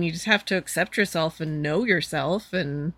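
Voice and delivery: ordinary talk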